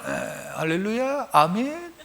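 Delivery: very soft, listless imitated amen; imitating a feeble congregational reply; mock half-hearted response. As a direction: mumble it back as a reluctant congregation. Mimics congregation's feeble response to his call.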